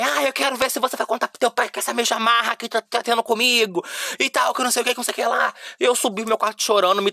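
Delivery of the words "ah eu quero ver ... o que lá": higher, taunting imitation of mom; mocking mom. Mom taunting her teen about telling dad, mocking and pitched up. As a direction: mimic mom's sarcastic threat, pitched up. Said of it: "Minha mãe".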